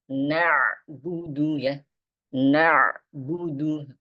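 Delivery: Na'budu with the ba pressed briefly, not stretched; pressed syllable. Shown as a demonstration. Teacher shows students how to press the letter. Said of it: "huruf tas itu ditekan".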